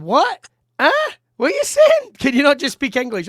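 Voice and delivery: very high, loud, incredulous mimicry; baffled locals. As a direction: squawk it as a mock-baffled foreigner. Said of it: "they're just sitting there going".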